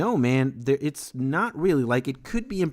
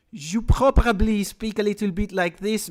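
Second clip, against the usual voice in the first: hammed-up French accent, higher at the start; strong French accent; 7 semitones higher, 3 dB louder than usual. Shown as a demonstration. Streamer showing a Quebec viewer how he might sound, strong French accent. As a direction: put on a very thick French accent. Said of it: "it's a very strong French accent".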